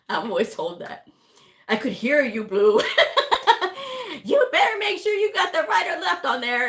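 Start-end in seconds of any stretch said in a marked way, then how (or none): 1.7-2.8 laughing quote. Retold or imitated. 2.9-4.2 loud, high laugh breaking out; high burst of laughter. Real, not acted. 4.2-6.7 laughing quote. Retold or imitated. Quoting while laughing.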